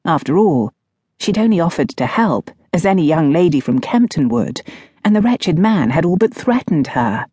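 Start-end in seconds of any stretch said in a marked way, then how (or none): none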